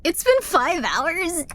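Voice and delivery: slow, high, drawn-out disbelief; stunned disbelief. Real, not acted. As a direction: let the shock stretch the words. Shocked at stream time.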